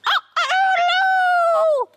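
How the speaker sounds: very high, ringing cock-a-doodle-doo; piercing imitated rooster call; shrieking cockerel crow. A narrator's reading. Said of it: "shrieked the cockerel loudly".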